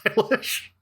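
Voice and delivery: a hint of an old politician gushing; mock Nancy Pelosi. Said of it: "Nancy Pelosi being like".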